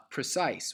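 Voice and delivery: precise with the stress on CISE; pre-CISE, weight on the second syllable; natural second-syllable stress. Shown as a demonstration. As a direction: normal iambic precise, rising to cise. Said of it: "obviously normally, naturally pronounced".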